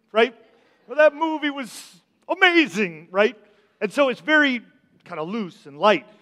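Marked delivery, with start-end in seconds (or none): 0.9-3.1 casual gushing. Retold or imitated. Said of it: "because we use it very casually".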